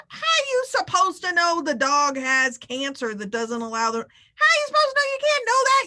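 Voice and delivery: high, loud exasperated disbelief; rising to a high, loud outcry of disbelief; incredulous. Real, not acted. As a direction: throw up your hands in disbelief. Host defends Olivia, incredulous.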